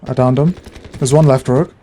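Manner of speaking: combat callout. Real, not acted. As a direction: shout the kill to the team. Calling out kills mid-fight.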